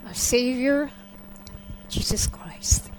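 soft, reverent telling with long pauses; hushed awe. Real, not acted. Believer describing her vision of the Savior, hushed awe. Recounts vision of heaven and Jesus.

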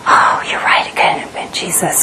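hushed whisper, giving in to fear; whispered agreement. Retold or imitated. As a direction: breathe it as a frightened realization. Ego convinces her, she concedes.

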